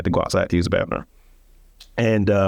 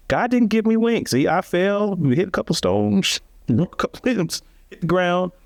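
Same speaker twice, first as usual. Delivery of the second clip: much higher, driving the point home; emphatic punchline; 11 semitones higher than usual. Real, not acted. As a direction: lift the whole line way up for the punchline. Punchline of his life lesson.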